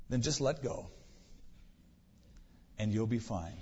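soft, low voice from heaven; calmly reassure, with a long pause midway; quiet, composed acted voice of God; deep, calm voice. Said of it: "Deep, calm voice".

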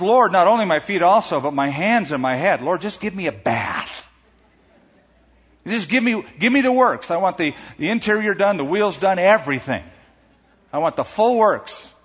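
gushing, over-the-top enthusiasm; overeager overreaction. Acted. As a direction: pile up demands with growing eagerness. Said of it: "from objection to overreaction".

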